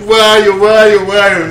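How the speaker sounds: loud high worried calling. Retold or imitated. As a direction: call out searching for someone. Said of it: "they all over, like".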